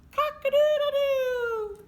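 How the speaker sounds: high, loud cock-a-doodle-doo; rooster crow. Acted. Teacher crowing like a rooster for the kids.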